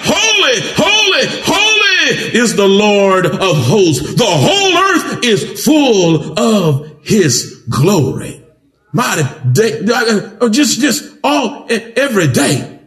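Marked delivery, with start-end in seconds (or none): 0.0-8.4 high ringing cry on Holy, holy; slow, soaring proclamation; angels crying holy. Acted. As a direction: call it out like heaven's choir. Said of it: "one cried to another".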